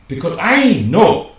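loud, rising insistence; emphatic outburst. Acted. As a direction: push hard on I. Dictator character insisting.

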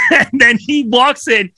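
usual speaking voice

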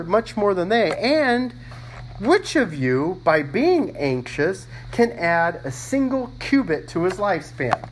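loud, high proclaiming of the verse. Acted. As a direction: preach the verse out with force. Reads verse aloud, raised.

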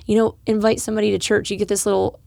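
ordinary talk, nothing marked

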